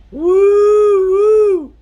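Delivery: high, loud double hoot; hollered cave call. Acted. Indian calling into another cave, high hollered hoot. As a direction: holler two long joined "woo"s. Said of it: "holler into the cave".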